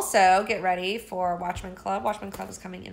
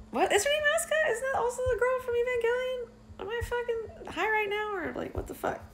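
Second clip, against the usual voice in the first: much higher, incredulous; baffled surprise. Real, not acted. Host realizing the name clashes with Evangelion, baffled and much higher. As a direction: catch yourself in disbelief. Suddenly doubting the character name.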